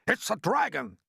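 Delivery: a crowd's high, alarmed cries; panicked shouting. A narrator's reading. Policemen and firemen naming the monster above, shouting in alarm. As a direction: shout wild guesses, each voice topping the last. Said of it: "all started shouting at once".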